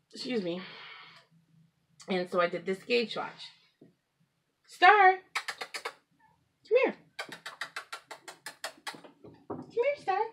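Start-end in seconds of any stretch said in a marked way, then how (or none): 4.6-10.2 high, loud call to a pet; sing-song call to bring the cat over. Real, not acted. Calling the cat to camera.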